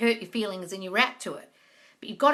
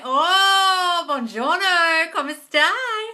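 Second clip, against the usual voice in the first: loud, high, drawn-out Italian gush; effusive Italian greeting; 7 semitones higher, 7 dB louder, much slower than usual. Pretend. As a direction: greet them in Italian with big, exuberant delight. Greets viewer in Rome with Italian.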